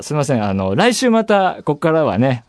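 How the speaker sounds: normal talking voice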